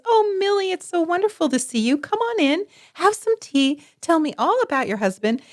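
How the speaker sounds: high-pitched, effusive mock welcome; gushing hostess mother. Mother greeting the neighbor she dislikes at the door, fake gushing. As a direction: put on an over-friendly hostess greeting. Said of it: "your mother opens the door and says".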